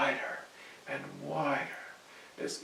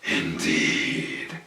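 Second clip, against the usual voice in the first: much lower, loud and very slow; menacing goblin king; 9 semitones lower, 6 dB louder, much slower than usual. Acted. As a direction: drag out each word to loom over him.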